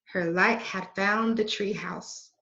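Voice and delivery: ordinary delivery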